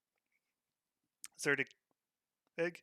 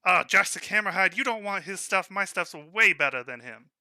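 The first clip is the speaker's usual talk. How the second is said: loud, higher, scoffing at the rival; scoffing shopkeeper; 6 semitones higher, 10 dB louder than usual. Acted. Gnome shopkeeper scoffs at the rival smith, loud. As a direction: wave off the competition loudly.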